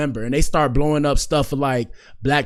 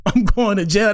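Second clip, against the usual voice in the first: higher, laughing through the dread; laughing despair; 6 semitones higher than usual. Retold or imitated. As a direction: laugh at your own doom. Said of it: "I feel like I'm cooked".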